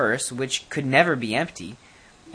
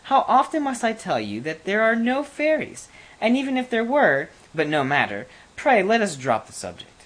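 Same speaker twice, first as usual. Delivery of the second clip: impatient queen; 8 semitones higher than usual. A narrator's reading. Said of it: "cried the queen".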